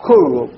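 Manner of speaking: "خروب" with a double r and no n; doubled r, n dropped. Shown as a demonstration. Teacher completes the rule for students, "خروب" with doubled r. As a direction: drop the n and press the r in "خروب". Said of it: "ويشددون الراء بحذف النون".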